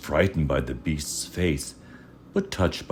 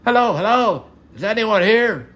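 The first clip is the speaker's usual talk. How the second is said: high, louder call into an empty castle; 7 dB louder than usual. Acted. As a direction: shout out to find anyone. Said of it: "when he called out".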